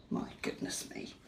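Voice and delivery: soft breathy whisper; whispered awe. Acted. Reading to a child about the scary cat, awed whisper. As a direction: hush it like a secret.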